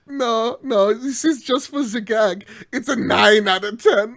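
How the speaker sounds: spring the joke on him gleefully; excited gotcha. Real, not acted. Host springing a fake score on his cohost, gleeful.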